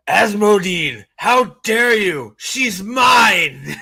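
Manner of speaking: hammed-up outraged shout; high, loud, drawn-out villainous indignation; Ravine. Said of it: "And Ravine goes".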